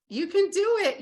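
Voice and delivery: high, loud burst of encouragement; rallying cry. Real, not acted. Coach urging listeners on, loud excited cheer. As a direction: shout it out to fire them up.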